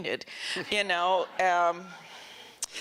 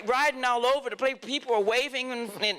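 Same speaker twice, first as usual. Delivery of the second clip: ordinary talk, nothing marked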